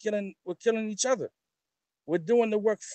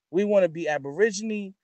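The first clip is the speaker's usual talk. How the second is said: ordinary talk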